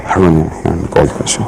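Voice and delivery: bad example of a too-shy pitch; shy and soft. Shown as a demonstration. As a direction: shrink away so he can hardly hear. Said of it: "You don't wanna be so shy, so soft".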